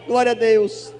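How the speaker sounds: loud shouted hallelujahs, glória stretched slow; preaching at full force. Real, not acted. Pastor celebrating the healing with the congregation, shouting praise. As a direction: shout praise to the whole church.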